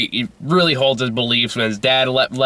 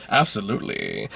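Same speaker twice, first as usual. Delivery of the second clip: put-on softer, languid English-accented absolutely; absurd English accent; 3 semitones lower, 6 dB quieter than usual. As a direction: purr it like a hammy Englishman. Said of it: "He just talks that Italian accent".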